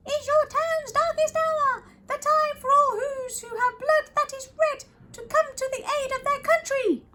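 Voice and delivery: very high, rallying little voice; rally them in a tiny high voice; mayor crying out. Acted. Mayor rallying townsfolk in darkest hour, high-pitched cry. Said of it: "cried the mayor".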